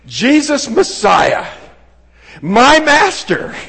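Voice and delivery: loud, high, slow proclamation; crying out. Acted. Blind man addressing Jesus as Messiah, crying out. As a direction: call out each title slowly and loudly. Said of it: "how you would more clearly say".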